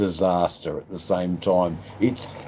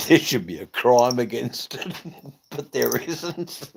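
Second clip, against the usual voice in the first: laughing; 5 semitones higher than usual. Real, not acted. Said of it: "laughs through the line".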